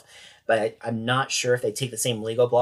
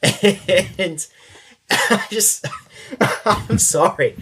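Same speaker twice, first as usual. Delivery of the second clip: helpless laugh breaking into the words, pitched up; real laughing; 7 semitones higher, 4 dB louder, much slower than usual. Real, not acted. Storyteller losing it at the wife's question, laughing. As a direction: apologise through laughter.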